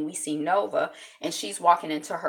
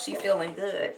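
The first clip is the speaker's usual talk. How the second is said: usual speaking voice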